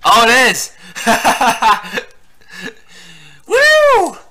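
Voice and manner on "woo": high, loud "woo"; excited whoop. Real, not acted. Player reacting to the game, excited whoop.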